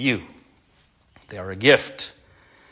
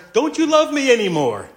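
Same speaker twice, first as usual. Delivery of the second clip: high, loud mock wail of a hurt wife; upset wife imitation; 13 semitones higher, 5 dB louder than usual. Husband imitates his crying wife confronting him, high mock wail.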